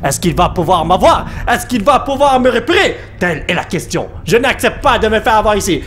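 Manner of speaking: usual speaking voice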